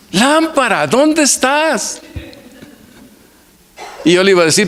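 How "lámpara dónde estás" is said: put-on much higher, slow, sing-song child's cry; child's shout. Preacher retells grandson crying out for the lamp, high and slow. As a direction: cry out to the lamp like a three-year-old, stretching the words. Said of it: "y grita".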